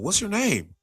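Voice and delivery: high-pitched imitated stage question; comedian quizzing pastor. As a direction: imitate a comic grilling an audience member.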